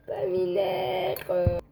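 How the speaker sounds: lent, plaintif, au bord des larmes; gémir de dépit; plainte traînante. Real, not acted. Ne trouve pas le vinaigre. (voix geignarde)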